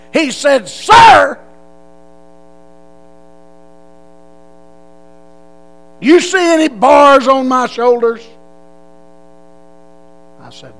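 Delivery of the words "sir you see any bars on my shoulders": loud, high mocking yell; a drill sergeant yelling at a recruit who called him sir.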